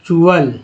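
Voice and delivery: चुअल said as chu-al, two syllables; model pronunciation. Shown as a demonstration. Teacher models the tual ending for students. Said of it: "क्या उच्चारण हो रहा है".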